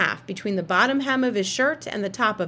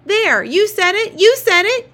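high, loud triumphant shriek, slowing on the first words; shrieking kid; 12 semitones higher, 10 dB louder than usual. A narrator's reading. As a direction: shriek it like you caught her out. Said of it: "Jamie shrieked".

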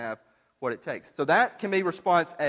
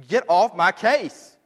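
louder, higher, defiant cry of "it's my life"; defiant outburst; 6 semitones higher, 6 dB louder than usual. Acted. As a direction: shout it like a rebel demanding freedom. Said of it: "who are you to say".

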